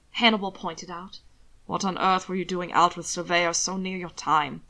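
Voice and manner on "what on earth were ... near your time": lightly lower, calm chiding lord; mild reproach. Acted. Hannibal calmly chides Alana, lightly lower voice. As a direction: gently scold.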